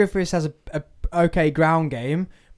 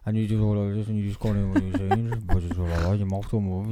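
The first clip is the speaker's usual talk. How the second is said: lower, softer mock mumble, mouth barely moving; mumbling impression; 7 semitones lower, 5 dB quieter, faster than usual. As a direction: mumble like the mate. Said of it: "More of a mumble".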